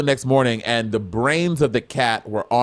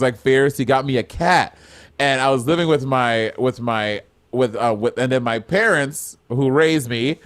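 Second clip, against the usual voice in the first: usual speaking voice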